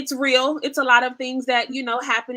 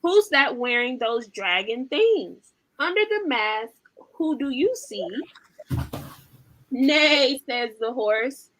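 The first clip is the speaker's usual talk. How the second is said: lively, sing-song storybook reading; animated picture-book read-aloud; reading to children; 3 semitones higher than usual. Acted. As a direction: read it like bedtime for toddlers.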